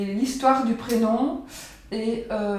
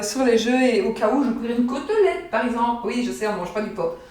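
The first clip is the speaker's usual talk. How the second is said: usual speaking voice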